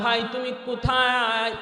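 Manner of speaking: high, slow and loud, in open wonder; amazed question. Retold or imitated. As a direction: ask the stranger slowly, full of astonishment. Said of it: "অবাক হয়ে জিজ্ঞেস করলাম".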